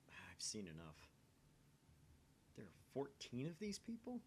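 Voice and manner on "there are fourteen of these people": can't believe the number; shocked question. Acted. Stunned reader asks about the fourteen victims, pitch jumping up. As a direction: voice jumps much higher in disbelief.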